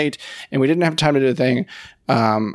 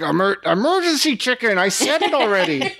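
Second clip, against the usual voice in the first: put-on a touch of childish exasperation, higher and laughing; mock annoyed kid; 8 semitones higher than usual. Boy repeats his answer to classmates, mildly annoyed. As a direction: roll your eyes at repeating it. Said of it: "he probably got annoyed".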